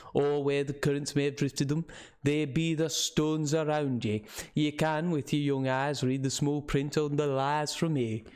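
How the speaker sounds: put-on old local man's northern English with Scots touches; rustic northern English, slipping toward Scottish; northern English dialect. Old man scoffing to visitors about warnings, northern English dialect. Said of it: "did not quite understand his dialect".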